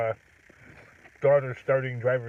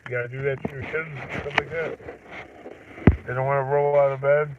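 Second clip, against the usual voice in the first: normal talking voice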